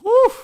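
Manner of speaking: short loud whoop; excited whoop. Real, not acted. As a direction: let out one quick cheer. Cheer before likes milestone.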